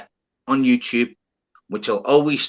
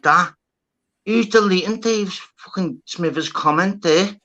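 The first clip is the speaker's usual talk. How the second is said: higher, outraged demand; mock furious interrogation; flipping out; 7 semitones higher than usual. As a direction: rage at the chat over deleted comments. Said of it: "I'm flipping on everyone".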